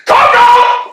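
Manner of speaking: loud high shout to rouse him; sudden loud wake-up shout; shouting awake. Acted. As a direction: yell to wake a sleeper. Waking the sleeping friend.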